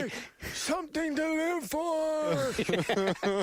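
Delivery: high, slow, stretched-out holler; mock old-man yelling, words dragged long; old guy yell. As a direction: wail it out as the old man's line. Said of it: "the old guy yells".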